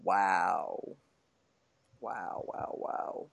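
deadpan fading wow; amazed wow. Real, not acted. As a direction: trail off into a muted wow. Reacts to cinnamon pick again.

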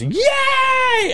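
squealing high yay of a winner; high cheer. Retold or imitated. Girl behind him cheering her win, squealing yay. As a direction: cheer like you just won.

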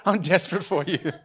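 sung line breaking into a laugh; a real laugh while singing. Real, not acted. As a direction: laugh through the lyric. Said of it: "if you guys peeped into my head".

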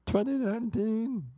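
very high mock Swedish-American lilt; hammed-up excited Swedish-ish accent; over-excited Uber driver. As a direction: imitate a too-keen driver, high and sing-song. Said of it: "That was kind of his voice".

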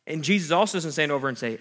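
ordinary talk

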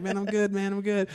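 high, strained polite reply; high forced-casual answer; forced politeness. Retold or imitated. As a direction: play along through gritted teeth. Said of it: "I'm like".

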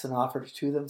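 usual speaking voice

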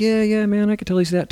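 high, easygoing dude voice; laid-back contractor. Retold or imitated. Contractor voiced to the host, loose and easy. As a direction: play the chill contractor.

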